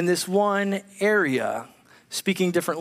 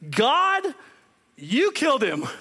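high, building charge that drops hard on the last words; a preacher voicing Peter's punchline; Peter's accusation; 10 semitones higher than usual. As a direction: lay out the titles, then land the blow.

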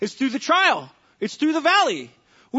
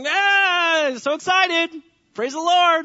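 mock cheerfulness; 7 semitones higher, 4 dB louder, much slower than usual. Mocking cheerful sufferers.

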